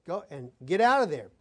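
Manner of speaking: usual speaking voice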